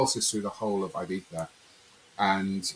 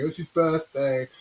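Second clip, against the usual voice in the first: high, lightly mocking singsong; put-on high, teasing fanfare; imitated birthday announcement; 9 semitones higher, 4 dB louder than usual. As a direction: imitate a crowd cooing over a birthday. Imitating those bringing the cake.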